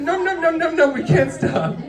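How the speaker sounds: hammed-up high num-num eating voice; mimicking eating. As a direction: chomp like a greedy little kid. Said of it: "So he's like".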